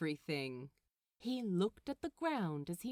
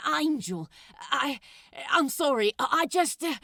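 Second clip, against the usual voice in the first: loud, stammering apology; blurting out sorry, tripping over words; flustered Crowley; 5 semitones higher, 11 dB louder, faster than usual. Acted. Crowley caught out, stammering sorry to the angel.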